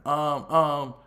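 hammed-up nervous stutter into a rushed excuse; nervous stammer. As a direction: stammer it out like you've been caught. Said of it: "she started stuttering".

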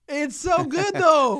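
high, loud burst of delight; real, over-the-top fan gushing; gushing excitement. Real, not acted. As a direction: squeal it with enthusiasm.

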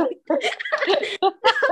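high, loud real laughter; high giggling fit. Real, not acted. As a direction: break into helpless giggles.

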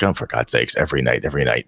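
low, muttered exasperation; lightly voiced fed-up brother; annoyed older brother. Older brother at the family table, mildly annoyed. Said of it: "her dad's getting annoyed, and the older brother".

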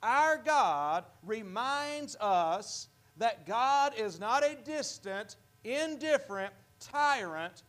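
solemn slowed stress on Our; slow emphasis. Shown as a demonstration. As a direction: draw out Our God deliberately. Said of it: "there's an emphasis there".

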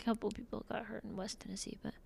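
hushed whisper. Acted. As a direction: drop to a whisper. Whispered aside.

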